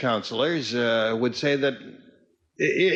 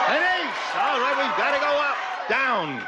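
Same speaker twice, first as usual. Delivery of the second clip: thrilled calling the cards; excited; 11 semitones higher than usual. Real, not acted. Contestant excited at card.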